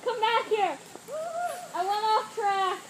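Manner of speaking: high, loud frantic call; loud and high, half laughing; excited yelling. Real, not acted. Car veers off during race.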